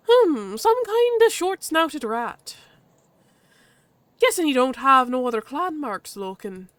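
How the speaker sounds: high, slow, appraising Irish badger; vaguely Irish accent. Badger inspects the newcomer, vaguely Irish. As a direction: size it up in an Irish lilt. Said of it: "the badger's vaguely Irish".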